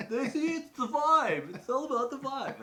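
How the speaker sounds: very high, loud, laughing mock triumph; gleeful crowing. Crowing to his companion about passing as local, gleeful. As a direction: squeal it in hammed-up vindication.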